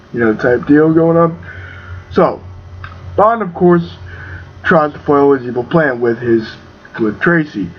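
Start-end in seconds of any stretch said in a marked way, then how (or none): none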